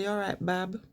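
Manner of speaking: a soft Birmingham greeting; Brummie. Shown as a demonstration. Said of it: "they say".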